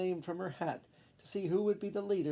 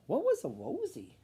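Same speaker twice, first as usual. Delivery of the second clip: high, puzzled question; 11 semitones higher than usual. A narrator's reading. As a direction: wonder aloud, baffled. Puzzled rodents.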